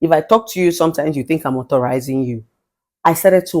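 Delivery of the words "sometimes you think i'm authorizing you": normal talking voice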